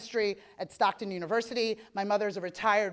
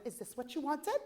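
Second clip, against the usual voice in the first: higher, softer mock-sweet imitation; imagined sister's voice; 4 semitones higher, 7 dB quieter than usual. Sister handing back the finished spreadsheet, mockingly imitated. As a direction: mimic your sister as she sounded in your head. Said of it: "this is how it sounded".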